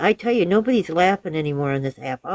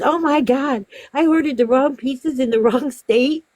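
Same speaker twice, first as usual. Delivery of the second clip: high-pitched mock shock; imitated dismayed realisation; 8 semitones higher than usual. Customer realises she ordered in wrong state, mock-shocked. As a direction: gasp out the mistake as the customer.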